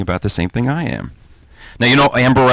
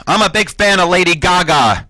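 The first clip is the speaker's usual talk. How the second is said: raised-pitch imitation of calling out; mock shout-out; 7 semitones higher than usual. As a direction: call it out higher, without real volume. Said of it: "Bill Clinton shouted out".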